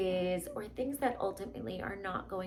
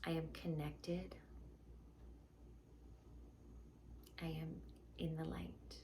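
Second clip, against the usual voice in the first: guided meditation; 3 semitones lower, 8 dB quieter than usual. Acted. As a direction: guide them gently into calm.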